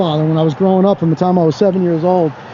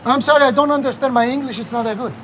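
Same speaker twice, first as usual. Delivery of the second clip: hamming up a Greek tourist who can't speak English; Greek accent; 3 semitones higher, 5 dB quieter than usual. Pretend. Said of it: "I'm gonna bust out the Greek accent".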